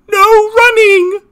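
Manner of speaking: high, loud, slow drawn-out teacher order; mock teacher. Teacher ordering kids fleeing bees to stop, mocking high shout. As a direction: shout it like a pompous teacher on yard duty. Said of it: "Fucking teacher".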